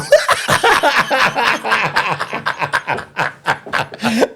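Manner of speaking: long, high laugh; helpless laughter at your own story; real drawn-out laughing fit. Real, not acted.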